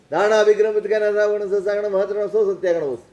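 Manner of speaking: rapid ritual recitation of the vow. Acted. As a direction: chant the vow formula for the listeners. Monk recites the vow formula. (પચ્ચક્ખાણનું પઠન)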